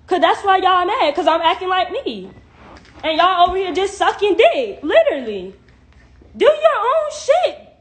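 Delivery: loud, high-pitched telling-off; angry rant. Real, not acted. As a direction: fire it at the haters, loud and high. Angry rant at live viewers.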